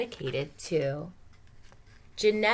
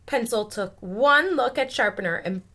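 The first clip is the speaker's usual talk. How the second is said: ordinary delivery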